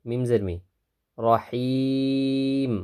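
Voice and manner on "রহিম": রহিম with the middle vowel held three counts; long vowel stretch. Shown as a demonstration. Teacher stretching রহিম for students. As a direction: draw out রহিম long and level. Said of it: "তিন আলিফ টানবো".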